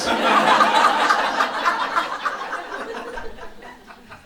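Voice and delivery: audience laughing; big laugh from the room; crowd laughter. Real, not acted.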